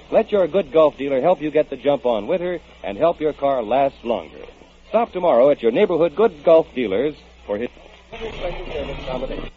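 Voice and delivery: usual speaking voice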